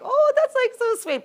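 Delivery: high, loud, gushing mock delight; mock sweet gushing. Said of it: "I'm like".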